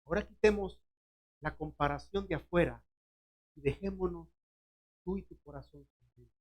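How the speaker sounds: Jesus quietly inviting Pedro to just his heart; Jesus speaking gently. Acted. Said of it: "Entonces Jesús dice".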